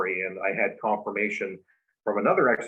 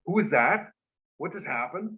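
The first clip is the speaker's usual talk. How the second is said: higher-pitched imitation of stunned bystanders; mock alarm, pitched up; shaken onlookers; 6 semitones higher than usual. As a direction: re-enact a shaken crowd's questions. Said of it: "they're all shaking, going".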